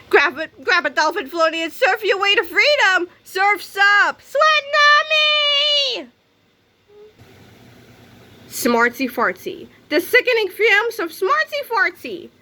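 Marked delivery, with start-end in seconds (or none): 0.1-6.1 rising to a long, high held cry; wild surf cheer. Acted. Surfing escape cheer. 9.9-12.3 high, dramatic announcer read of Smartsy Fartsy; trailer announcer. Shown as a demonstration.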